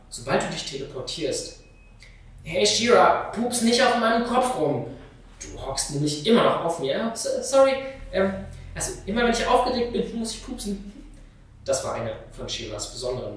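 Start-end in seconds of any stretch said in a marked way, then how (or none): 2.4-7.1 lauter und höher, genervt; genervtes Schimpfen. Acted. Lamina schimpft über die Schwester. 7.1-10.9 höher und schnell, verlegen; hastige Entschuldigung. Acted. Shira entschuldigt sich verlegen.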